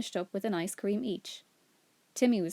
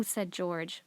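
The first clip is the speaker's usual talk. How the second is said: plain reading voice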